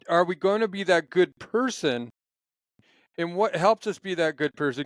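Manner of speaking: ordinary talk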